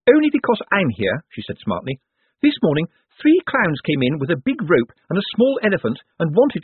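a no-nonsense woman proud of throwing clowns out; brisk Miss Drapes. A narrator's reading. Miss Drapes voiced in the story.

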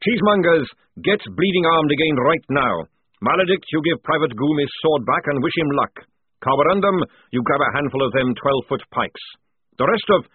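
parade-ground sergeant taking charge; barked orders. A narrator's reading. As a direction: rap out orders to the squad. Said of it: "went straight on to".

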